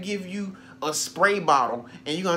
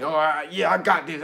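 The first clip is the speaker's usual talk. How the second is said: mock prideful; 4 dB louder than usual. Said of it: "egotistical and prideful".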